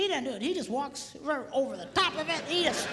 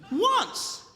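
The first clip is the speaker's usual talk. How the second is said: startled; 9 semitones higher than usual. Retold or imitated. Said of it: "that same startled look".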